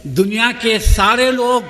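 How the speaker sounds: ordinary talk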